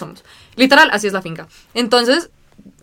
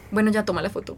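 much softer, imitated resignation; resigned, not even angry; 11 dB quieter than usual. Imitating Mateo waiting for a photo, soft resigned voice. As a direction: let it drop, quietly giving in. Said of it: "ni siquiera emputado, pero estaba como".

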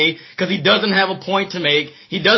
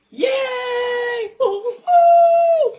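crowd cheering; much slower than usual. Acted.

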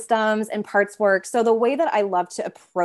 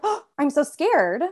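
a hint of a frightened little kid, higher and slow; scared inner child; 6 semitones higher than usual. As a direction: lightly voice a small scared child. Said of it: "if my inner child's like".

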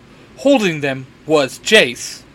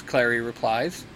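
ordinary delivery, nothing marked

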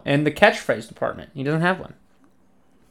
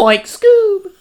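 high, loud Shaggy voice through a laugh; Shaggy impression, laughing; 7 dB louder than usual. Answering his friend with the Shaggy line, high and loud. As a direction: hit Shaggy's line, cracking up. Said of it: "one line of Shaggy".

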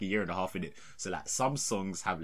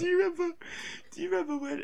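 laughing. Real, not acted. Still laughing, pitch high.

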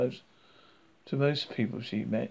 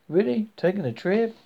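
high, louder Southern curiosity; lightly voiced Southern woman; friendly Southern accent; 6 semitones higher, 6 dB louder than usual. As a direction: perk up like a nosy Southern neighbour. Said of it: "speaking in a friendly southern accent".

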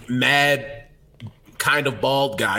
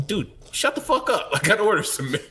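imagined fed-up barista; 8 semitones higher than usual. Retold or imitated. Barista to over-greeting customer, mock fed up. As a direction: brush off the chirpy customer. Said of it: "They're just like".

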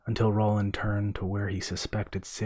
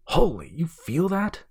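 higher, louder jolt of alarm; startled; 9 semitones higher, 6 dB louder than usual. A narrator's reading. Brother feeling the water move in the dark, startled. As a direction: burst out as if something just brushed you.

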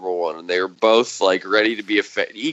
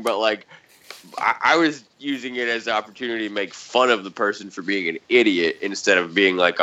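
ordinary delivery

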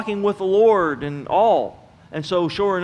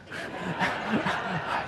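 short laugh; 9 dB quieter than usual. Real, not acted. Preacher laughs at own joke.

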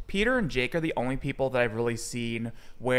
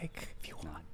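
unvoiced whisper close to the mic; microphone whisper; 12 semitones lower, 18 dB quieter than usual. Real, not acted. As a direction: whisper it so only the mic hears. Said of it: "a totally acceptable microphone whisper".

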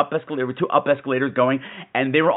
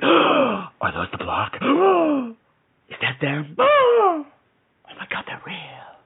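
slow, gasping, then whispered awe; gasping wonder; 9 semitones higher, slower than usual. Retold or imitated. Seeing slummy buildings from the train, gasping in awe.

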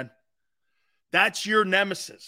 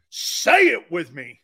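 loud slow prompt; 2 semitones lower, 7 dB louder, slower than usual. Shown as a demonstration.

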